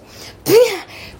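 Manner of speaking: loud high laugh; a real laugh breaking out; high burst of laughter. Real, not acted. Laughs at the clown memory.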